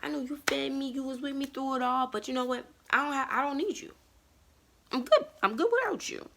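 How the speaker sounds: a spoiled flower brushing off its gardener; high mocking kiss-off voice; sassy rose. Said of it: "And it's like".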